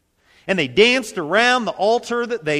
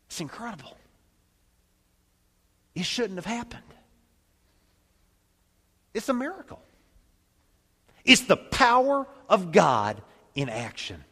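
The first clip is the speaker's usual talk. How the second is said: awed; 2 semitones higher than usual. Real, not acted. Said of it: "I get goosebumps".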